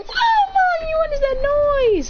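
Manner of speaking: put-on very high crying little-girl squeal; mock crying, pitched far up; Sakura. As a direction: wail it slowly to mommy, hammed-up. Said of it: "Sakura's crying in her house".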